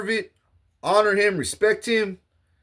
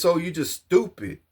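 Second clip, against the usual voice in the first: usual speaking voice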